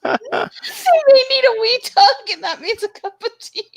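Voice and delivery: high, drawn-out words through helpless laughter; talking through a fit of giggles; laughing. Real, not acted. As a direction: squeal it out while cracking up. Laughing while repeating the phrase.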